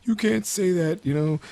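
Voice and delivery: high-pitched imitated protest; mock indignation. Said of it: "gets all mad".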